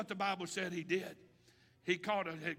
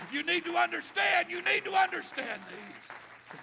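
building call; 7 semitones higher, 10 dB louder than usual. Acted. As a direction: start level, climb to a loud, high cry.

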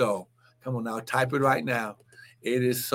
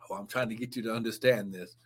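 crying, lower and softer, rushed; tearful plea; 9 semitones lower, 7 dB quieter, faster than usual. Real, not acted. Preacher to congregation, crying while pleading to be understood. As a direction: plead through tears.

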